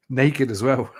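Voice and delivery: laughing through the words. Real, not acted. Telling mates about his brother, laughing through it. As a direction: giggle it out despite the grim story.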